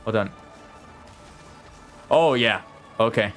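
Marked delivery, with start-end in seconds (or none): none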